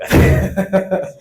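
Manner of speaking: short, high burst of laughter; amused laugh. Real, not acted. Speaker amused at being called executive director, laughing. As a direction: laugh at your own grand title. Laughs at own grand title.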